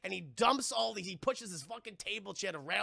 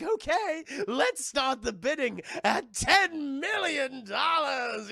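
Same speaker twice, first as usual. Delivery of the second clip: very slow, much higher, louder mock announcement; auctioneer villain; 7 semitones higher, 6 dB louder, much slower than usual. Ivy opening the bidding, slow hammed announcement. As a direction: announce it grandly. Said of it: "It's like".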